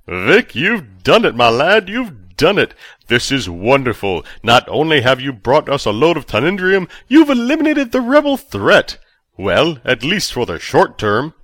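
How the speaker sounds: high, loud, overjoyed official; giddy chancellor. A narrator's reading. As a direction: burst out with delight like a giddy schoolboy. Verbidi's excited lines.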